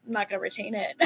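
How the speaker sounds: trailing into a laugh. Real, not acted. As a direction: laugh through the end of the line. Laughs at own short attention.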